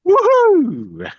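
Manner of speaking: high loud woohoo; cheering whoop. Real, not acted. Co-host cheers.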